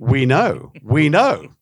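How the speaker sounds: women in the audience answering, loud and knowing. Retold or imitated. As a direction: say it like every wife in the room agrees. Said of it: "all the women were going".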